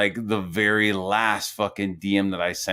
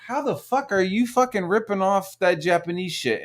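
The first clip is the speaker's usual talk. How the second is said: higher-pitched, incredulous outburst; retold indignant challenge; indignant disbelief; 9 semitones higher than usual. Artist calling out a rip-off, indignant. Acting out outraged reaction to copying.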